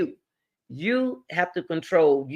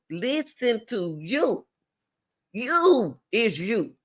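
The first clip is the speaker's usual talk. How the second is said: YOU drawn out slow and higher; stress on YOU; 4 semitones higher, slower than usual. Real, not acted. Urging young women to trust themselves, stress on YOU. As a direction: lean hard on every "you", stretching it. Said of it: "I'm putting emphasis on the emphasis word".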